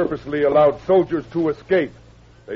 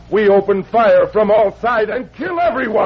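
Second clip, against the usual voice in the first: louder, menacing vow; fierce threat; 7 semitones higher, 6 dB louder than usual. Acted. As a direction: spit out the final threat. Chief vows massacre.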